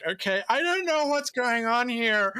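high-pitched mock confusion; comic bewilderment. Reacting to being banned and welcomed at once, comic bewilderment. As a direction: pitch jumps up, baffled.

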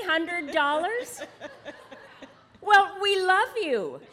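loud, laughing disbelief; excited laughing. Real, not acted. Same table wins again, laughing.

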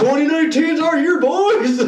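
hammed-up hype; high, loud meme quote; mock hype announcement. Quoting a meme.

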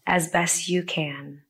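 usual speaking voice

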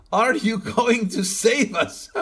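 put-on Eastern European accent. Stranger asks the new doctor for rescue, in a laughing, hammed-up Eastern European accent. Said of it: "He had a very Eastern European accent".

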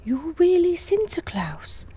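slow, breathless whisper; awed whisper. Acted. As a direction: whisper it in wonder. Awed child meets Saint Nicholas.

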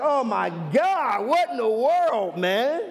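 hammed-up exasperated outcry; acted dismay. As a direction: cry out in mock horror, stretching the words. Acts out shock after honeymoon ends.

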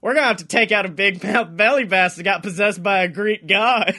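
ordinary delivery

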